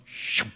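spoken whooshing noise; whoosh sound. Retold or imitated. Preacher shows pieces coming together, imitated whoosh. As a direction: imitate a quick rush of air.

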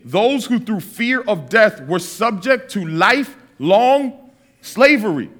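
slow, weighty reading of scripture; measured scripture reading. Acted. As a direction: read the verse slowly and solemnly. Reads scripture slowly.

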